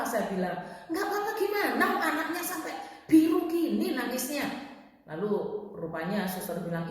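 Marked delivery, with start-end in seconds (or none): 0.9-4.5 high-pitched, indignant retort; indignant protest. Retold or imitated. Mother objecting to nurse over crying baby, indignant protest. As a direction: protest in alarm, pitch jumping up.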